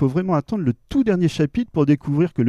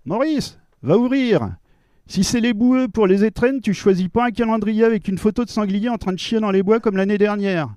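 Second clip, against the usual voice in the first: higher nagging wife voice; a hint of a nagging housewife; 8 semitones higher than usual. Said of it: "Je vous le mets en scène".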